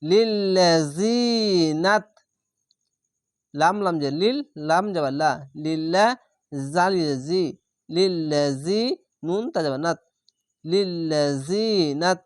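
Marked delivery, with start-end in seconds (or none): 0.0-2.1 লিল্লাযিনাত held long with a doubled ল; slow recitation. Shown as a demonstration. Teacher demonstrating the verse, slow recitation. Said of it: "teacher demonstrates recitation". 10.6-12.2 লিল্লাযিনাত with the ইয়া vowel stretched one alif; slow recitation. Shown as a demonstration. Teacher showing the vowel length, slow recitation. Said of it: "এক আলিফ টেনে পড়বেন".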